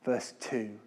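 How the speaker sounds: usual speaking voice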